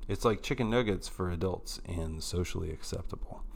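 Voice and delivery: a quick whispered sponsor joke; whispered aside. Acted. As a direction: drop it as a sneaky whisper. Whispered aside after sponsor read.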